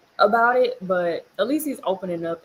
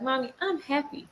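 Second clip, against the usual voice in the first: put-on higher, softer little-kid voice; imitated child; 5 semitones higher, 5 dB quieter than usual. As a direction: lightly mimic a child reassuring mom. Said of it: "And then she's like".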